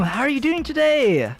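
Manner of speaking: ordinary talk, nothing marked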